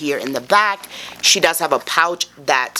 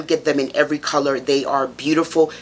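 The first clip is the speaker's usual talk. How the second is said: ordinary delivery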